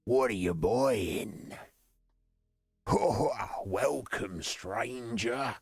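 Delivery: gravelly, drawn-out shady trader voice; slow, gravelly impression of a mysterious merchant; original merchant. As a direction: rasp it out slowly like a sinister peddler. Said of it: "there's a bit more gravel in him".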